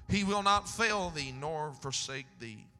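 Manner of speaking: scripture read with full preaching force; booming sermon reading. Acted. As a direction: proclaim each phrase to the church.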